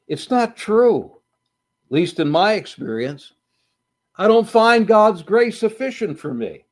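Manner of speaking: a grieving father arguing with God; despairing complaint. Acted. Grieving pastor voiced, despairing.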